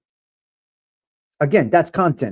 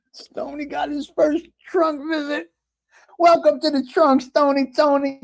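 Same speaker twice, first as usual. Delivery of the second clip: higher, hammed-up gangster voice welcoming Stony to the trunk; mock mobster boss; 11 semitones higher than usual. Host putting chatter Stony in the trunk, hammed-up mobster. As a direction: play a gleeful mob boss dishing out punishment.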